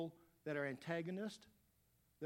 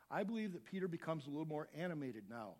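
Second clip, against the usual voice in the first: ordinary talk, nothing marked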